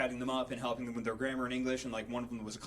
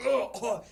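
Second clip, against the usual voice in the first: mock choking cough, higher and louder; coughing grunts; 7 semitones higher, 6 dB louder than usual. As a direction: cough out the whiskey. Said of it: "and he goes".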